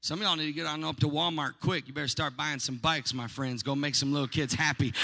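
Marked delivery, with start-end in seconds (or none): none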